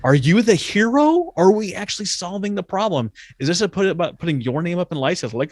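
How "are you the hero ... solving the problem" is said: challenging. Real, not acted. Challenging rhetorical question.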